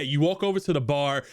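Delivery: ordinary delivery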